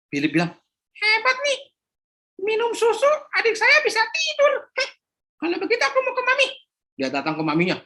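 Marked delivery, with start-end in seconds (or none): 1.0-6.5 put-on high childish voice; a little boy at the shop counter; young boy. Philip speaking to shopkeeper Pak Joko, high child voice.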